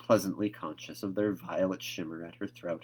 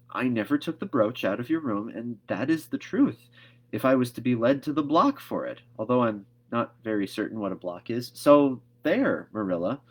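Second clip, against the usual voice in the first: higher, rising to emphatic So there; earnest Anne; 4 semitones higher, 6 dB louder than usual. A narrator's reading. Anne vowing truth to Marilla, emphatic. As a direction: swear it with conviction.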